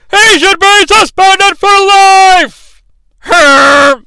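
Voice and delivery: high, loud, slow outraged call; outraged commentator. Retold or imitated. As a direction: cry it out like a shocked commentator. Imitating Gorilla's outraged call.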